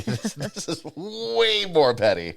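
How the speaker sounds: drawn-out laughing emphasis. Real, not acted. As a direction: laugh through the words. Laughing about pettiness.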